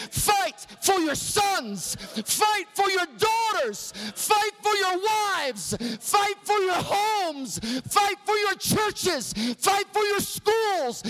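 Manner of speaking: rally the men, hammering every fight; full-force preaching. Acted.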